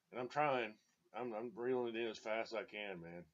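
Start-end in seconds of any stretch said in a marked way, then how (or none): none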